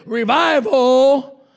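slow, loud, weighty pronouncement of the preacher's words; Marlando's words. Retold or imitated. Retelling what Marlando told the revival, slow and booming. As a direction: drawl it out like a visiting evangelist. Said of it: "and Marlando said".

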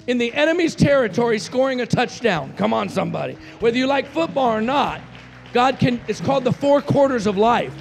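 forceful revival preaching; impassioned preaching. Real, not acted. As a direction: drive it home to the congregation, loud.

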